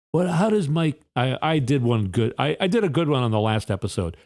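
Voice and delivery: usual speaking voice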